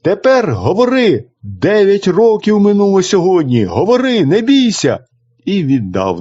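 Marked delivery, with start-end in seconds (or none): 0.0-5.0 higher, urgent calls to the sister; a brother urging his silent sister to speak; calling out. A narrator's reading. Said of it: "домчав і вигукнув". (брат вигукує до сестри, вище й наполегливо)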